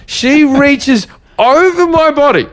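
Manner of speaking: slow, high-pitched indignation; drawn out in high, incredulous outrage; outraged disbelief. Real, not acted. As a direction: stretch it out, scandalized at the nerve of it. Outraged retelling of seatmate's act.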